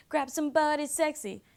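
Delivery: high-pitched mimicked lyric; mimicked party music. Quoting the music that pushes grabbing at parties, mock lyric voice. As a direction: pitch it up like a party-song hook. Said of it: "so reinforced by the music".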